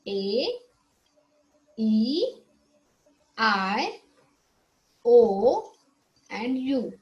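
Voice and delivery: each vowel said alone with a pause for pupils; model vowel recital. Shown as a demonstration. Teacher leading the class to repeat the vowels. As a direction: say each letter clearly for them to echo. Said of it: "can you repeat this?".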